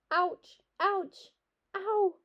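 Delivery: high-pitched little ouches; pained yelps. A narrator's reading. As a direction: cry out lightly at a sting. Mother's bites dabbed with alcohol.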